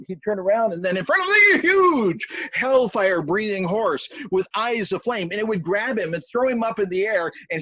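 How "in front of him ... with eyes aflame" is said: spring the monster on the listeners, big and drawn out; booming storyteller's shock moment; sudden dramatic reveal. A narrator's reading. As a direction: burst out louder and higher.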